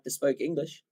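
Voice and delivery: broken English in a light mock Afrikaans accent; jokey Afrikaans accent. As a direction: tease your own English with an Afrikaans lilt. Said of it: "fun to speak in an Afrikaans accent".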